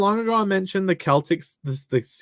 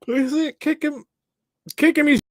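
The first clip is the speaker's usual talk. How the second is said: excited urging; 11 semitones higher, 3 dB louder than usual. Real, not acted. Urging high-pitched.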